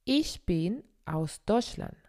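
usual speaking voice

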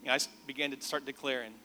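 normal talking voice

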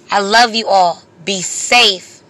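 ordinary delivery

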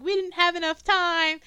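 high, loud mock wail; mock crying. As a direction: imitate a tearful, distraught woman. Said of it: "she's crying. She's like".

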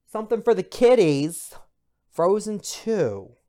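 high and loud, slowing right down on the title; showy announcer. Acted. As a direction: sell it to the kids. Announcing the kids' movie.

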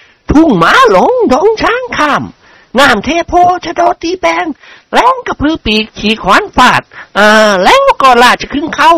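high lady's voice reciting a list; innocent-faced noblewoman ticking off items; deadpan counting recital. A narrator's reading. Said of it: "พลางพูดเบาๆอย่างหน้าตาเ".

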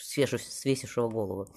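usual speaking voice

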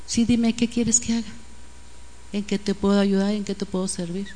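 soft, low, attentive voice; gentle offer. Pretend. A believer answers someone asking to talk, gentle offer.

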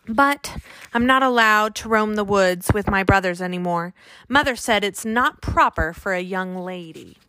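ordinary delivery, nothing marked

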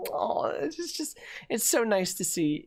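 very high, airy lady voice; touched dog owner. Acted. Lady watching dog lick mailman, gushing. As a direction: gush softly as a moved onlooker.

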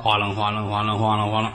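imitated rattling. Fortune-teller shaking sticks in a cup, mimicked rattle. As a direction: rattle the syllables off evenly.

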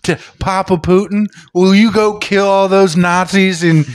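high, wheedling sweetheart voice; mock sweet pleading. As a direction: coax him like a pampered lover, slowing at the end. Acts out coaxing Putin as lover.

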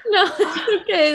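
high laughing reassurance. Real, not acted. Guest laughs to reassure the tearful host, laughing. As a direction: laugh while reassuring her.

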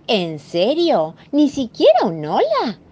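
an offended housewife getting no hello; indignant Mrs Moe. Acted. Mrs Moe scolding the rude monster, indignant high voice.

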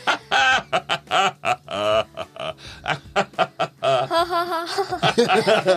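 loud hearty laughter on request; laughing loud as asked; loud laugh on cue. Shown as a demonstration. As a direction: belly laugh for the group. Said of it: "Louder, [name], louder".

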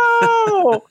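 high, loud mock-horrified scream; screaming mom. Mom reacting to her libertarian kid, mock scream. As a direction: scream it as a hammed-up horrified mother. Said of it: "the mom's just screaming".

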